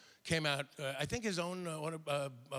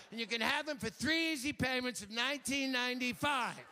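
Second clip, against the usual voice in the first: high, gushing home-shopping pitch; TV shopping host; 8 semitones higher than usual. Pretend. Hawking bracelets to viewers in a high salesy voice. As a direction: sell the jade like a cable shopping host.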